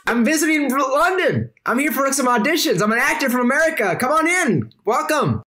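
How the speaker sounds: a silly high-pitched dude greeting guests; Francisco Rodriguez. Acted. Odd host greets the vampire guests at his table, in a high goofy voice. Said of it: "he looks at you all and says".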